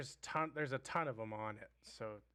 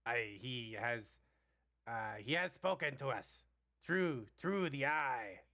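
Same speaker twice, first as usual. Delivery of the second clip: goblin voice. Acted.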